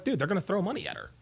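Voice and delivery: rattled off fast and casual; quick throwaway. Retold or imitated. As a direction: toss it off quickly, like it's obvious. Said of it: "I was like".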